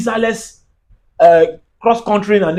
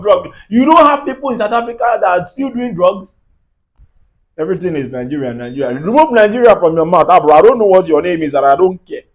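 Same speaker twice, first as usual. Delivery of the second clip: ordinary talk, nothing marked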